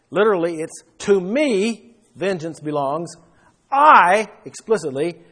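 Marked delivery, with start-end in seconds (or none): 1.0-3.1 TO ME leaned on, raised in pitch; emphatic. Shown as a demonstration. Preacher stressing whose vengeance it is, emphatic. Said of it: "It's very emphatic". 3.7-4.3 "I" punched high and loud; emphatic. Shown as a demonstration. Preacher stressing God himself will repay, emphatic. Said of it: "It's very emphatic".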